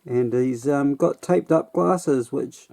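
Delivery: normal talking voice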